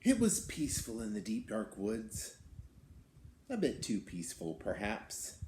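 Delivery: put-on lively read-aloud for children; storybook reading. A narrator's reading. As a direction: tell it like a picture book to kids. Reads the beast's tale aloud.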